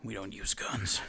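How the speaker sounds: low, hushed hero voice; quiet, deep superhero impression; Batman. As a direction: say it low and soft, a terse order. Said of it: "Batman's like".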